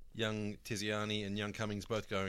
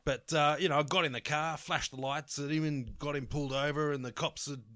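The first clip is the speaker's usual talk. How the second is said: higher, louder Aussie bloke telling his tale; excited bogan witness; 6 semitones higher, 4 dB louder than usual. Retold or imitated. Neighbour in his jocks recounting the ram raid to reporters, excited bogan voice. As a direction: play the neighbour in his jocks gabbing to the news crew.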